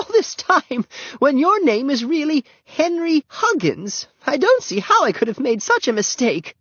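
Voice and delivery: high, slowish fluttery lady's apology; apologetic old lady. A narrator's reading. Missus Peabody apologising to Henry for the wrong name, fluttery. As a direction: fuss over your mistake.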